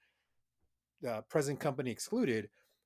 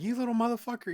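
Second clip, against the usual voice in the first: mock scolding; 10 semitones higher, 5 dB louder, faster than usual.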